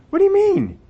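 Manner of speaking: high, far-off mocking mourner voice; a scoffing mourner, voice shot up high; incredulous mourner. Mourners voiced reacting to Jesus.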